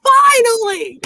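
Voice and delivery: very high relieved shout; exasperated shout. Retold or imitated. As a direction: cry it out like at last. Imitating Emily's exclamation.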